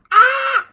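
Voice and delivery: put-on high, loud, frightened scream of kids. Kids at a Ouija board panicking, high loud scream. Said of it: "kids freak out".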